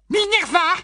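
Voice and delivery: tiny high-pitched cry; high squeaky voice. A narrator's reading. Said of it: "s'exclama une petite voix aiguë".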